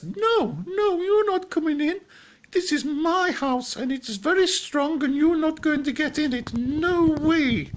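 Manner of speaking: very high, squeaky defiant piglet; loud falsetto piggy standing firm; little piggy. Acted. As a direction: squeal the refusal in a tiny high pig voice. Little pig refusing the wolf.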